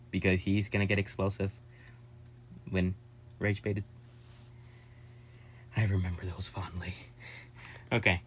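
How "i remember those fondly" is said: soft breathy whisper; whispered aside. Real, not acted. Fond whispered memory to viewers. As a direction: lean in and whisper it fondly.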